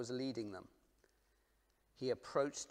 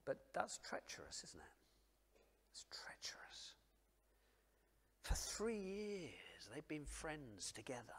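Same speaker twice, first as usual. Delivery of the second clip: whisper; 3 semitones higher, 7 dB quieter than usual. Acted. Preacher dwelling on Judas, in a whisper. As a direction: let it fall to a breath.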